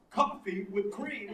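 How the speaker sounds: very slow, with long pauses, Walken-style. Retold or imitated. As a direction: stretch it out like Walken. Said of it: "the fucking pauses are still there".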